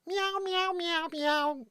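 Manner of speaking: high mock meowing; cat meows. Mocking cat claims, high meowing. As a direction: meow like a cartoon cat.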